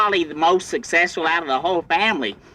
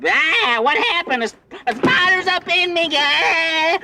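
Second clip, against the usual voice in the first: panicked high yelp. Acted. Uncle panics about spider.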